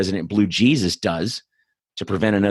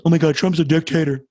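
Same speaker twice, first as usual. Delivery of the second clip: mock panic; 8 semitones higher than usual. Mocking people losing their mind over Trump, high mock hysteria. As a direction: ham up a panicking liberal. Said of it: "everyone's, like, losing their mind".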